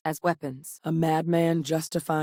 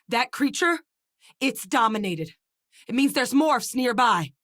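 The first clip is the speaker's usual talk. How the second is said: alarmed warning; 7 semitones higher than usual. Acted. Warning of nearby monsters.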